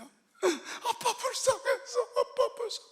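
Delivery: put-on high, slow tearful little child; crying child. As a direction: plead like a small child crying for dad. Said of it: "아빠를 붙잡고 우는 거야". (아빠를 붙잡고 우는 아이처럼 높고 느리게 울먹이며)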